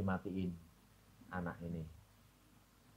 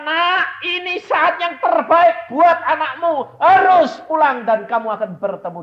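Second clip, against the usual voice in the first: God speaking loudly; 15 dB louder than usual. Retold or imitated. God telling the grieving mother, loud and high. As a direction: declare it from above. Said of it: "Tapi Tuhan berkata".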